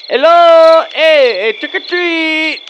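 loud, high, drawn-out holler; calling at the door. Acted. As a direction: shout trick-or-treat to whoever is inside. Shouting at a house door.

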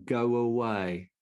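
slow, drawn-out mock shout; retold shout. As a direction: imitate a heckler's yell, held back and stretched. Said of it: "he shouted it at the top of his voice".

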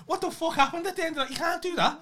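very high-pitched retold disbelief; hammed-up indignation, pitched right up; mock outraged protest. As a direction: protest in a squeaky-high, exasperated retelling. Retold protest at video's cliffhanger ending.

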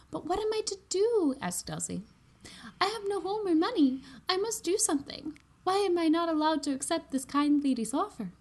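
put-on high, distressed girl voice; a young woman begging to know why; pleading young heroine. Heroine pleads in read story.

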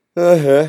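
a loud, high yawning "Hè, hè"; yawning sigh. Acted. Boy waking up in a strange bed, loud yawning sigh. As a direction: stretch out a big waking yawn on "Hè, hè". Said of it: "geeuwde hij nogmaals".

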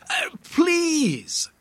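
very high, drawn-out plea; beg, stretching the word high; pleading. A narrator's reading. Guide begs the departing tourist not to go, pleading. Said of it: "you plead".

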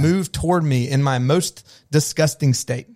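put-on high, self-assured child voice; confident child. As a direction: play a toddler sure everyone adores them. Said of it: "my children who'd come into a room and be like".

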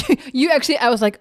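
normal talking voice

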